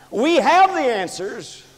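high, driving pulpit delivery; full-force preaching. Real, not acted. Preacher charging church with a greater crime, full-force preaching.

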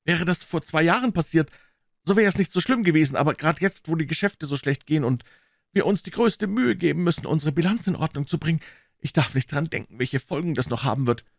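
lightly voiced worried visitor; mildly agitated, sinking in pitch toward the end; distressed brother. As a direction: fret aloud about the consequences.

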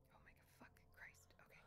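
quick hushed curse; scared whisper. Real, not acted. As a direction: whisper it, bracing for danger. Hiding from monster.

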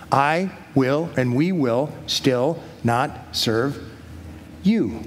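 slow, word by word with pauses; deliberate resolve. Acted. Three men refuse the king's gods, slow deliberate resolve. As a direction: set each word down separately.

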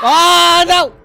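loud, high cry of dismay; shout of dismay. Real, not acted. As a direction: shout it as you die. Killed in game.

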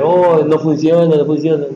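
mock childish whine; mock whiny student. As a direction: mimic a childish complainer. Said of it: "Eres niño, pues".

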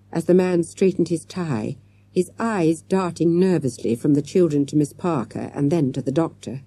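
plain reading voice

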